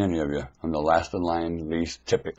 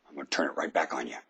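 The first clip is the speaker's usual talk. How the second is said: whisper; 3 semitones lower, 8 dB quieter, faster than usual. Real, not acted. Sudden hush in sermon.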